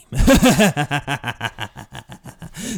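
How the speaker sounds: evil laugh. Acted. After creepy trailer line.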